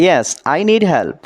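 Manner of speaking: very slow, careful English; slow model sentence. Shown as a demonstration. Teacher modelling English for Bengali learners, very slow and careful. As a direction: stretch every word for learners. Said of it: "এখানে yes মানে হ্যাঁ".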